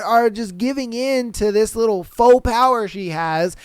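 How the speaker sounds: usual speaking voice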